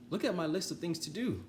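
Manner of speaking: high, proud Toad showing off; storybook Toad, proud. Acted. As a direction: hold it up high and eager.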